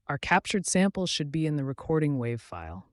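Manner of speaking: usual speaking voice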